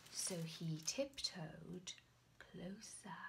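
soft, slow whisper; hushed suspense. A narrator's reading. Narrator whispering as the knight tiptoes closer. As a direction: creep the words along quietly.

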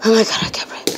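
whispered and winded; breathless whisper. Real, not acted. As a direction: whisper it, catching your breath. Breathless after escaping hiding spot.

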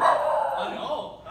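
sudden loud breathy oh; a loud, breathy gasp of surprise; startled oh. Real, not acted. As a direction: caught off guard by the dog, blurt it out. Dog does something unexpected.

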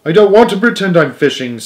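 desperate cry. A narrator's reading. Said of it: "cried Stuart desperately".

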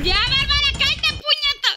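high-pitched, fed-up telling-off; exasperated scolding. Real, not acted. Scolding rival player mid-game. (regaño agudo y exasperado)

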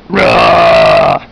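deep growling monster roar. Acted. Pet monster Psycho Pants roars at the guy, deep growl.

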